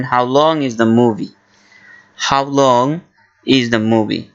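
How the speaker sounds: very slow, careful English; slow model phrase. Shown as a demonstration. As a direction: say it slowly for learners. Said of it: "ইংলিশে জিজ্ঞাসা করবেন". (ধীরে ধীরে স্পষ্ট উচ্চারণে)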